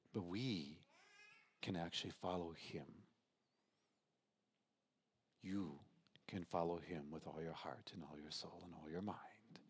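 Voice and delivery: tearful whisper. Real, not acted. Emotional close of the sermon.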